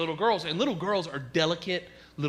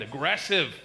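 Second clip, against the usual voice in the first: ordinary talk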